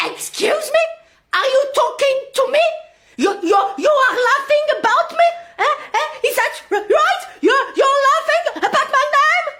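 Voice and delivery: high-pitched, incredulous challenge in English; indignant confrontation. Acted. Teacher confronting class for laughing at her name, indignant.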